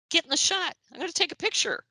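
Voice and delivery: very high-pitched mock pestering; insistent photographer. Photo editor telling reluctant writer to join, high mock insistence.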